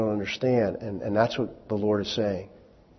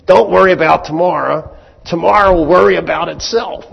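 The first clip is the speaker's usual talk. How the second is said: higher, louder mock-casual line; playful retelling; 7 semitones higher, 10 dB louder than usual. Shown as a demonstration. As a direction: toss it off like a joke. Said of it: "I'm gonna put it in other words".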